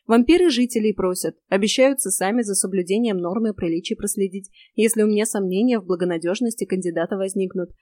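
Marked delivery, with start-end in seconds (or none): none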